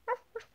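high yappy mock barks; squeaky puppy ruffs. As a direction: yip like a little dog. Wolf barking.

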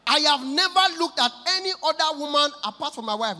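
a hint of preacher; preaching husband. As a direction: declare it to the congregation. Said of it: "husband dey preach in English".

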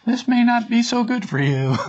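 high, small cautioning voice; little voice. Retold or imitated. Inner voice advising against getting one's way, high little voice. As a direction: imitate a tiny conscience up high. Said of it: "explained it in this little voice".

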